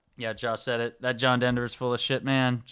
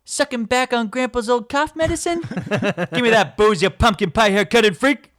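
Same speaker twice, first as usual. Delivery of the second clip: much higher and louder, a hammed-up scolding cop; mock patrol officer; 11 semitones higher, 8 dB louder than usual. The patrol officer scolding the driver, in a high hammy voice. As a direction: bark it like a pompous patrolman. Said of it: "the patrol officer".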